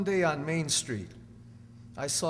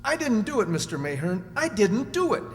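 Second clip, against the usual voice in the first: louder, higher desperate protest; pleading; 5 semitones higher, 5 dB louder than usual. Acted. Accused pleading his innocence to his lawyer, desperate. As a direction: beg him to believe you.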